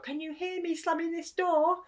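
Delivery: high, slow, drawn-out taunting yell; imitated attention-seeking shout; mock childish shout. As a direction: call out slowly like a tantruming child. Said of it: "and shout".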